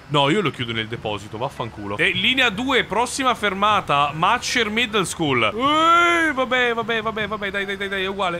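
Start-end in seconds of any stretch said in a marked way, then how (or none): 2.0-5.4 higher, sing-out stop announcement; bus announcer voice. Pretend. Driver announcing the school stop, mock announcer voice. 5.5-8.4 high yelp easing into hurried muttering; flustered dismissal. Real, not acted. Driver after a mishap, flustered brush-off.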